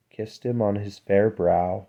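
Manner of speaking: ordinary delivery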